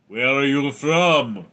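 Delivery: slow mock-Russian accent; Soviet Russian accent. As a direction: put on a drawn-out Russian accent. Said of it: "Soviet Union accent made".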